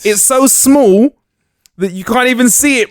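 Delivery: real excitement, stretching key words high; over-excited. Real, not acted. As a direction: get carried away pitching a gadget. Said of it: "I got a bit too excited there".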